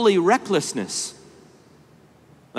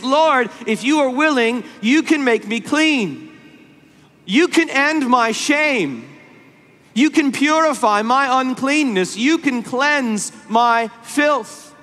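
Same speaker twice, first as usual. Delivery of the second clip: high, impassioned plea, slowing at the end; desperate begging; 6 semitones higher, slower than usual. Retold or imitated. Leper falls on his face before Jesus, begging desperately. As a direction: plead with mounting desperation, pitched well up. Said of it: "falls on his face and begs Jesus".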